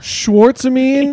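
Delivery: very high, stretched disbelieving question; incredulous. Real, not acted. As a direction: repeat the word in disbelief. Incredulous repeat of odd word.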